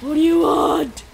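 high, loud put-on villager voice; character voice. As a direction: squeal it like a silly villager. Voicing a game character.

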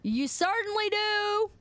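high, slow, drawn-out jeer; mock taunt. Streamer teasing back at chat, high drawn-out mock taunt.

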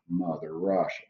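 low, soft mock Russian accent; deep, hushed put-on Russian. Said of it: "That's my Russian accent".

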